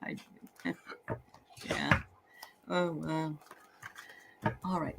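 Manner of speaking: normal talking voice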